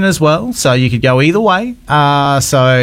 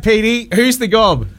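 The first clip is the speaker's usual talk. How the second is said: ordinary talk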